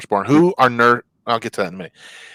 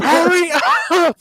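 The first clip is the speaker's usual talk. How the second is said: high, pushy hurry-up; impatient fan; 4 dB louder, slower than usual. Retold or imitated. Wife shooing the nurse so she can keep watching, high impatient call. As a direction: wave the nurse away to get back to the fight. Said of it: "She was like".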